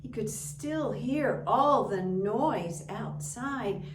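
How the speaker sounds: usual speaking voice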